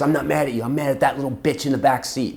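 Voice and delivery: high, loud retold blame; angry mother. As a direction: spit out the accusation as the mom. Retold furious mother blaming her daughter.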